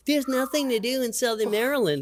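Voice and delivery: high, mocking whine; bored complaint. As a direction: imitate bored teens. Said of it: "People used to say".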